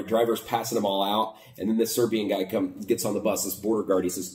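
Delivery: ordinary delivery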